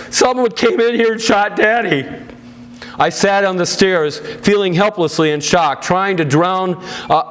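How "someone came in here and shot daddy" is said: higher, hurried panicky voice; panicky mother. A narrator's reading. Mother telling her daughter the father was shot, panicky and higher. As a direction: sound frightened as you break the news. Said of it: "in a high-pitched, panicky voice".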